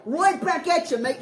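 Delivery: high, loud cheeky Aussie line; Australian accent. Acted. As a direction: toss it back like an Aussie larrikin. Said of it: "Do you say it in Australian accent?".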